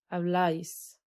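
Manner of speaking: habláis with the stress on -láis; stress on the accent. Shown as a demonstration. Teacher showing the accent on habláis to students. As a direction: lean on the accented syllable of habláis. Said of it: "عشان الأسنتو".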